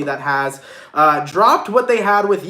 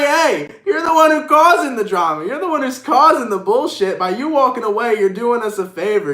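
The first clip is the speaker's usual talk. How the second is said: higher and louder, laughing at first; sassy callout; 6 semitones higher, 5 dB louder than usual. Retold or imitated. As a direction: tell off the fake friend with glee. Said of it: "You're like".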